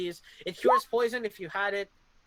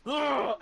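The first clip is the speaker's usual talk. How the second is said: sharp gasp; 6 semitones higher, 5 dB louder than usual. Acted. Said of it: "the giant goes".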